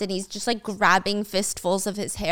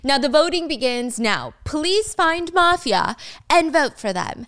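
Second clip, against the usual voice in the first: mock announcer; 6 semitones higher, 3 dB louder than usual.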